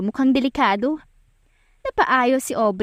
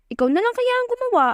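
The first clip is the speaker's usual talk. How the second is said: much higher, fast mocking jab; sassy retort; faster than usual. Aubrey sasses Damian about giving Vincent work, sassy. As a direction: throw it back at him cheekily. Aubrey snaps back at boss.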